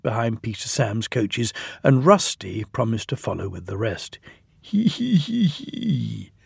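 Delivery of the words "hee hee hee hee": high-starting, falling "hee hee" snigger; sly snigger. A narrator's reading. Sir Handel gets away with his trick, sniggering. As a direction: snigger to yourself, hammed up. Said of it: "sniggered Sir Handel".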